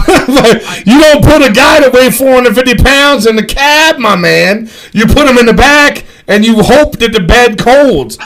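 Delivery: loud, high-pitched incredulous ribbing; exasperated rant. Retold or imitated. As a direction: tell him off loudly, half laughing. Berating Mike over the truck bed.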